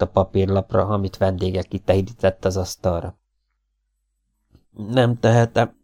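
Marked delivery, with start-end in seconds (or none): 4.7-5.8 slow, higher, pained refusal; anguished. A narrator's reading. Lee refusing to sign under pressure: mild anguish. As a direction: force the words out in torment. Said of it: "mondta kínlódva".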